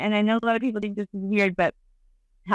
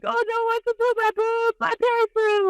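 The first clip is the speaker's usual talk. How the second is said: much higher, fretful mock friend voice; 12 semitones higher than usual. The nervous friend comparing boobs, high mock fretting. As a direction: whine it like the worried friend, way up high. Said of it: "So she was like".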